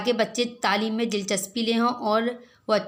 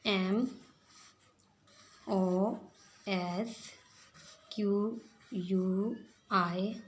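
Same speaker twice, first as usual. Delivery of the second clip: slow spelling; 2 semitones lower, 7 dB quieter, much slower than usual. Shown as a demonstration. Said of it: "slow spelling for children".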